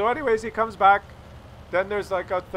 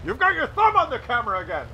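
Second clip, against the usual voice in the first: higher mock scolding husband; mad dad; 9 semitones higher, 4 dB louder than usual. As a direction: scold her about the thumb. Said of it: "he gets mad at her".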